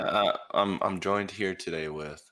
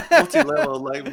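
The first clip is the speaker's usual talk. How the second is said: squeaky high; 9 dB louder than usual. Acted. Laughing mock emphasis.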